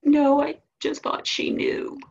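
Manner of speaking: put-on high, slow childlike whine; upset little boy. Boy admitting it to his mom, whiny. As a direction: draw it out high like a sorry kid.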